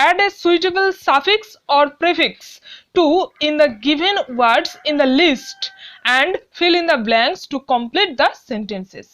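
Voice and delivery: very slow, deliberate read-aloud of the instructions; slow classroom reading of the task; slow dictation reading. Shown as a demonstration. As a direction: read it out slowly so pupils can follow. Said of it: "teacher reads instructions aloud".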